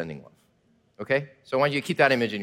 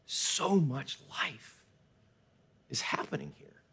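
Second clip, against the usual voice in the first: very slow, then softer into a whisper; whispered awe; 9 dB quieter, slower than usual. Retold or imitated. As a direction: breathe it out in wonder. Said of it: "like we said in that forest".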